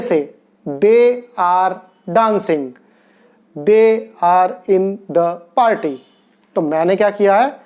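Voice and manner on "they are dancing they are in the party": very slow, word by word; slow dictation; slow example. Shown as a demonstration. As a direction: stretch each word for learners. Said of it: "teacher slow example sentence".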